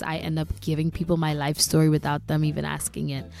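ordinary talk, nothing marked

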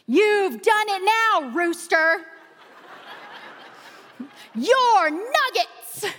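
put-on high, drawn-out battle cry of a boy; hammed-up triumphant threat, slow and high; imitating yelling son. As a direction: mimic a kid's gleeful war cry. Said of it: "They're yelling, they're screaming".

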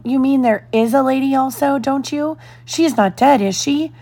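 a worried twin begging to know; anxious child. A narrator's reading. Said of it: "cried the first twin".

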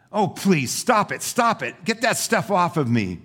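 high pleading that drops at the end; crying out. Acted. Clay being painted amid fumes, crying out in mild distress. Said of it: "I cried".

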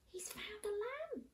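soft, high, breathy delight; hushed excitement. Acted. Storyteller hushes the children as Ben finds a lamb. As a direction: whisper it like a secret discovery.

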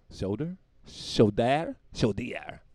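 slow, overdone "shoulder" with a stretched ending; exaggerated pronunciation. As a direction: play with the word "shoulder", drawing out its second syllable. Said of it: "Shoulder, soldier".

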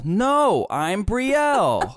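put-on high, drawn-out childish insistence; Brielle as child. Little sister correcting someone, high and emphatic. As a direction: protest like a stubborn tiny girl. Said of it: "it's little tiny Brielle that said".

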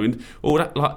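usual speaking voice

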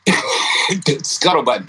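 usual speaking voice

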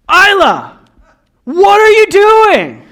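high, loud, drawn-out shout; exasperated dad yell. Retold or imitated. Father retelling his outburst at his toddler, exasperated yell. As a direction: yell at the child, stretching the words out. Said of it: "'cause Daddy yelled at her".

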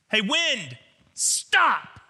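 high, forceful shouted command; sharp rebuke that jumps up on STOP; loud rebuke. Acted. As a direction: order the wind to stop, loudly. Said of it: "he rebuked the wind".

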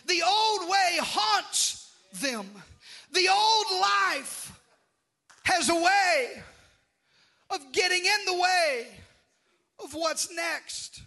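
slow, high, loud sermon cry; warning the church about the old life; full-force preaching. Acted. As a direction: drag each word out, preacher's roar.

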